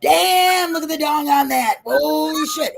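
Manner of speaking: high, loud mock amazement; hammed-up awestruck shout; loud shameless gawking. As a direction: exclaim for the whole shop to hear. Acting out loud comments in store.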